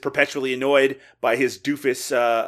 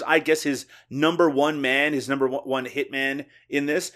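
usual speaking voice

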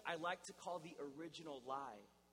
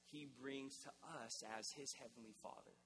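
ordinary talk

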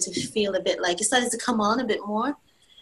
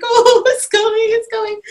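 high, giddy squeal with a laugh; excited; 13 semitones higher, 9 dB louder than usual. Retold or imitated. Mother-to-be thrilled that labour is starting, giddy and high. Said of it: "I was really excited".

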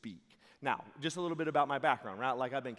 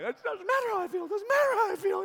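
much higher, fast and louder, repeating in fear; hammed-up frantic repetition; panicked mantra; 12 dB louder, faster than usual. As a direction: babble it to calm yourself.

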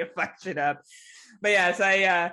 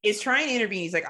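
normal talking voice

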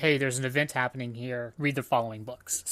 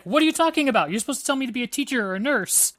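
high, loud, fast protest; mock indignant girl; 9 semitones higher, 3 dB louder, faster than usual. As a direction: imitate a girl's incredulous objection. Acts out girl protesting to teacher.

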